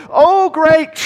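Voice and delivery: very high, loud pompous cry; pompous young man. Acted. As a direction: still grand while catching breath. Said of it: "this kind of pompous numbskull".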